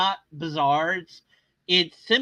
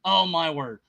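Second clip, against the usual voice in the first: ordinary delivery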